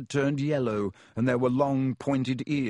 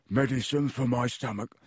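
grunted. Acted. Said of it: "Ron grunted".

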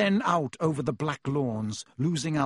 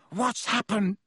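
high, gasped question; 6 semitones higher than usual. A narrator's reading. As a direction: gasp it out, higher, in alarm. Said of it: "Ron gasped".

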